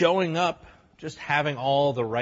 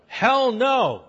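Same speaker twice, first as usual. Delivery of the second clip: louder, higher punchy refusal; emphatic hell no; 10 semitones higher, 5 dB louder than usual. Shown as a demonstration. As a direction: bark it as a flat refusal. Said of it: "one of his beautiful, beautiful hell no's".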